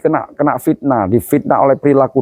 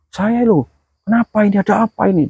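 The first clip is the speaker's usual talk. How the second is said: high, frantic bewildered cries; panicked soul; 9 semitones higher than usual. Acted. Preacher voicing a dead man seeing his family weep, high frantic cries. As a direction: cry out lost and terrified.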